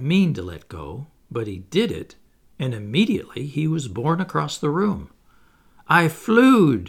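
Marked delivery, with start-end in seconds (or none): 5.9-6.9 a louder, higher squeal of glee; Michael in midair, a loud mock scream of delight; excited scream. A narrator's reading. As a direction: shout it out in pure joy. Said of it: "he screamed".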